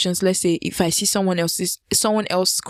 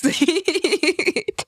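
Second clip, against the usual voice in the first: short laugh; 10 semitones higher than usual. Real, not acted. Laughs at own arrest fear.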